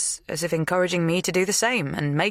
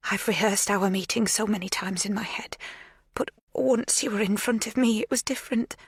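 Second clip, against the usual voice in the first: nervous confession; 6 semitones higher than usual. A narrator's reading. Magda confessing her nerves.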